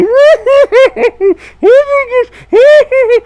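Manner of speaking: high, loud cackling laugh; shrill hooting laughter; muffled laughing. Retold or imitated. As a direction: imitate a friend cracking up. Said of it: "I hear Falcon's eyes go".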